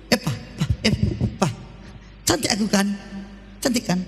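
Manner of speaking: merayu minta dipuji, agak rendah; sedikit suara istri manja. Pretend. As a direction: istri menggoda suami. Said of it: "istri merayu suami". (istri manja merayu suami)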